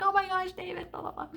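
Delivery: small, high, softened inner squeal; nervous fluster. Retold or imitated. Girl near her crush in group work, nervous fluster. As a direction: imitate your own nervous crush thoughts, high and tiny. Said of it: "I would just get so nervous".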